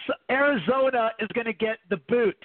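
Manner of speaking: exaggerated Canadian accent on "boot". Shown as a demonstration. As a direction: give "boot" the Canadian vowel.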